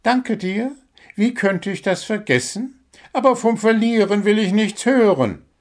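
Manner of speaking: a bird coach praising and correcting a player; small bird coach. Acted. Coach bird giving the squirrel feedback. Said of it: "sagte Zwitschi entschieden".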